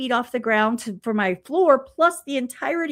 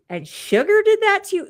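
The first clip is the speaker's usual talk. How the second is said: incredulous mom; 8 semitones higher, 3 dB louder than usual. Retold or imitated. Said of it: "she's like".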